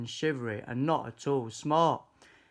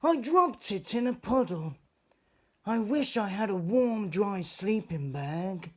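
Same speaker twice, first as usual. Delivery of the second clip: high sad fox voice; sad little fox; 7 semitones higher than usual. A narrator's reading. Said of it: "who was crying".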